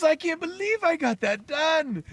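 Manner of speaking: high, loud relieved amazement; acted elation. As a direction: exult as if the ordeal is over. Hen-man exults after laying.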